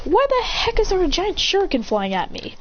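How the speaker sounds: high, startled complaint; indignant alarm. Acted. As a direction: protest in mock alarm. Nearly hit by giant shuriken.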